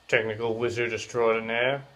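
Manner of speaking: normal talking voice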